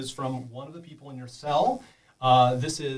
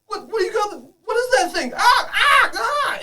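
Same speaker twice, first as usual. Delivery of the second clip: high, loud panic rising into a scream; terrified at the thing you see; alarmed cry; 12 dB louder than usual. Acted. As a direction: captive screaming as the thing comes at her. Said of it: "You're like".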